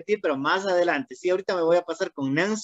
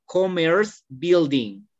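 "Commerce building" said very slowly and clearly; slow model phrase; much slower than usual. Shown as a demonstration. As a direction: model it slowly for her to repeat. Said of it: "Repita después de mí". ("Commerce building" muy despacio)

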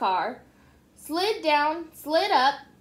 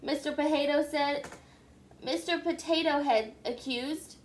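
usual speaking voice

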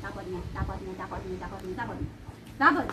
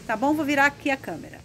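usual speaking voice

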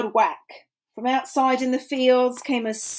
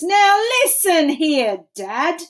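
Onceler yells at the Lorax, louder; a mild yell, higher and louder; 7 semitones higher, 4 dB louder than usual. A narrator's reading. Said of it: "I yelled at that Lorax".

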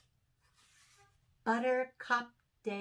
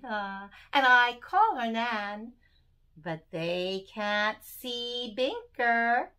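lively, sing-song storytelling read; poem for children; 2 semitones higher, 6 dB louder than usual. Acted. Reading a Binker poem aloud to young children, sing-song. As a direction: bounce through the rhymes for little listeners.

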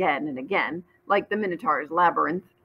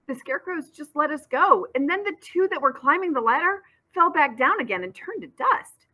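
excited telling; 8 semitones higher than usual. Acted. Ollie excitedly tells what happened.

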